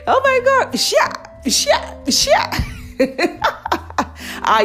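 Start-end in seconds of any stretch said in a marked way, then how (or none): none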